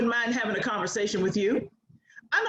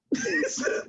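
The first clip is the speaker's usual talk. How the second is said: high laugh. Real, not acted. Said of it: "And I'm like".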